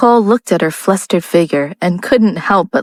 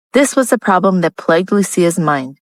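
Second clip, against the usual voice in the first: plain reading voice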